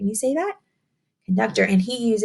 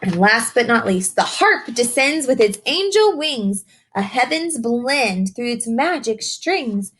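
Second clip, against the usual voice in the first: slower, higher storybook read with a lilt; picture book reading; 5 semitones higher than usual. Acted. Teacher reading a rhyming book to young children, sing-song storytelling. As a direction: read the rhyme to the children, savouring each line.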